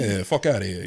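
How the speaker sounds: put-on lightly done Jersey Italian accent. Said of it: "So speaking my Jersey Italian accent".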